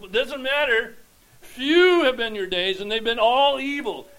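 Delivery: full-force preaching. Acted. Preacher reminding Jacob of God's care, at full force. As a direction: preach it out to the back row.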